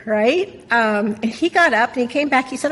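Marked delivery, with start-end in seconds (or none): none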